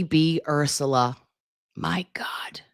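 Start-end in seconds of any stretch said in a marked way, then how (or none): 1.8-2.6 hushed, breathless whisper; shocked whisper under the breath. Real, not acted. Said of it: "Say it louder, [name]".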